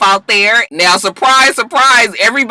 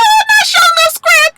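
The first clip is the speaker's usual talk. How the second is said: very high mock squeal; squealed surprise; 3 dB louder than usual. Reacting to a jailed boyfriend on the phone, squealed surprise. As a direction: shoot way up in teasing amazement.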